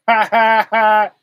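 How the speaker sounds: loud open belly laugh; big laugh. Real, not acted. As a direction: let a full laugh burst out. Hearty laugh in the sermon.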